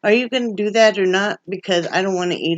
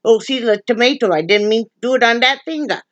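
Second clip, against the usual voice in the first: hammed-up Italian accent, pitched up; put-on Italian accent; 6 semitones higher than usual. Talking dinner plans to her partner in a mock Italian accent. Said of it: "you see the Italian come out on me now".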